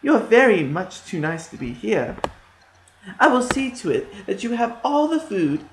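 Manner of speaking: high scheming witch; false kindness of a witch. A narrator's reading. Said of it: "the witch told them".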